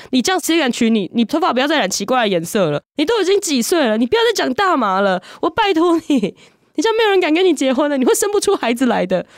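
high, rising nag of an anxious mother; nagging mother. Retold or imitated. Mother nagging about marriage.

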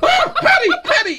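very high, hammed-up alarm; mock-horrified yelp of a child's name; panicked cry. Dad who can't stop peeing warns toddler, hammed-up panic.

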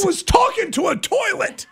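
real high-pitched exclamation; exasperated outburst. Real, not acted. Host argues the confession was said to a toilet, exasperated. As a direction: burst out high in disbelief.

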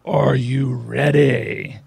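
monster truck voice. Pretend. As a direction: drag out the hype like a wrestling intro. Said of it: "you sounded like Triple H there doing the introduction".

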